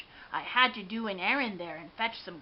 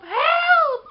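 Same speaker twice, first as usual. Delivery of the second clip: high, loud desperate cry for help; yell for help; 12 dB louder than usual. Acted. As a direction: shout it like your life depends on it. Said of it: "I yelled for help".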